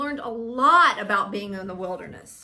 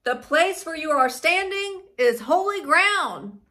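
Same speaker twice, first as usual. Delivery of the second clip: raised, loud, commanding proclamation; thundering voice; 6 semitones higher, 6 dB louder than usual. A narrator's reading. God warning Moses of holy ground, thundering. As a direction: proclaim it forcefully from on high. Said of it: "the voice thundered".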